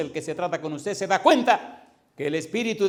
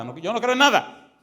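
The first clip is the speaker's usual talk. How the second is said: mock exasperated outburst; 6 semitones higher, 6 dB louder than usual. Atheist caller insisting to the pastor, mock exasperated. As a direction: imitate an insistent caller, rising high and loud.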